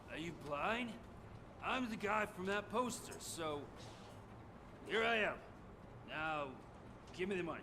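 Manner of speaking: impatient demand. Acted. Wanted man demands his own reward from the officer, loud and impatient. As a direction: push hard for what you are owed.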